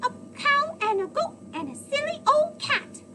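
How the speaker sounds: high, loud gleeful chant; laughing singsong. A narrator's reading. Gingerbread man boasting as he runs downhill. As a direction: crow about escaping everyone. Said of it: "he began to laugh and sing".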